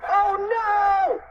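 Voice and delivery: very high, loud cartoon wail; alarmed cry. A narrator's reading. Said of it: "cried Cheeseball".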